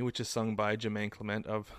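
ordinary delivery

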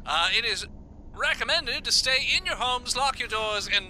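steady radio news read; news report. Acted. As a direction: read it like a broadcast.

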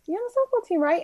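imitated high, eager waitress; excited fan. Waitress recognizes the softball player, imitated high and eager. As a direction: pitch it up like a curious fan.

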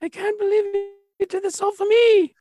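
put-on very high, girlish swooning voice; amazed falsetto love interest; gushing Cho. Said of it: "shows Cho the".

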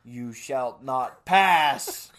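slow, grave, solemn decree; mock wizard proclamation. Blocking the aisle with a fake sword, slow mock wizard decree. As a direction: intone it like an ancient wizard barring the way. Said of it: "with a rather large fake wiener and go".

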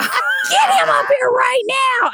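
high, drawn-out shouted order; retold outraged shout, slow and high; furious mom yell. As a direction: summon a child in big trouble. Mother retells furious order.

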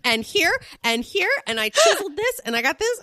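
high, proud show-and-tell; Sonia. Retold or imitated. Mimicking Sonia showing Andy her body, high and boastful. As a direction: list your procedures with shameless pride.

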